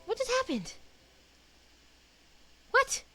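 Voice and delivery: normal talking voice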